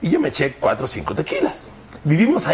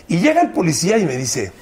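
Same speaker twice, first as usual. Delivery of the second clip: normal talking voice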